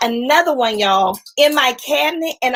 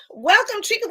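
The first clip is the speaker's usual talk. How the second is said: excited greeting; 8 semitones higher than usual. Real, not acted. Greeting a viewer on livestream.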